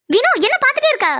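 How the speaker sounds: high-pitched, impatient prod; exasperated, pitch well up; urgent scolding. Acted. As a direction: push a friend to act. Scolds friend for just watching.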